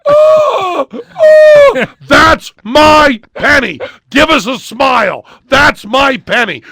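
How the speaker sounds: high, loud screaming with cries. Retold or imitated. Patient screaming the magic line in agony, screaming. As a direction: scream it through sobbing pain. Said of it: "I was just screaming".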